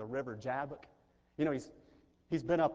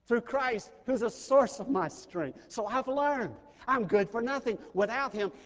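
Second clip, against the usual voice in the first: high, loud, ringing pulpit climax; preaching at full force; 7 semitones higher, 7 dB louder than usual. Acted. Pastor lifting his congregation to the sermon's peak, loud and high. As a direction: build it like a revival crescendo.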